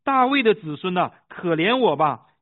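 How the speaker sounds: high, loud, insistent plea. A narrator's reading. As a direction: shout it out as the beggar who won't be silenced. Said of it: "他却越发大声喊着说".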